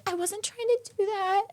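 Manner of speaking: high, soft, slow pleading imitation; imitating an over-earnest apologizer; mock genuine apology. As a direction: plead innocence in a small high voice. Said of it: "she was trying to be, like, a genuine apology".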